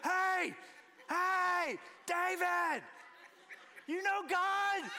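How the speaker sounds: put-on high child's shout calling a friend, slow at the end; loud boyish call, stretched on the last words; shouting across the room. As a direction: yell it out like an eager boy across a room. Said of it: "across the room".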